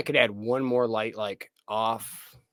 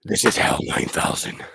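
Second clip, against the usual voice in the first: creepy fake voice; 2 semitones lower, 3 dB louder than usual. Showing the red light to his guest, a creepy host voice. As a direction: growl it like a horror channel host. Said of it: "something like that".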